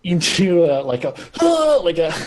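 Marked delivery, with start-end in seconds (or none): 1.2-1.8 sharp, high gasp; sudden gasp. Acted. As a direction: gasp as if bursting into action. Demonstrating a sudden burst.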